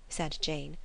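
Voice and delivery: ordinary delivery, nothing marked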